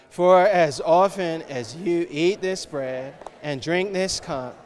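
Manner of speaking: slow, loud recited verse; scripture reading. Acted. Congregation reading the verse aloud together, slow and loud. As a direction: read it out together slowly.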